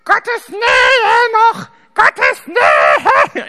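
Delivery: put-on high, loud, very slow child's shout; drawn-out high child yell; crowed child shout. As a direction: bellow it across the Kita like a little boy. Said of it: "kräht der so".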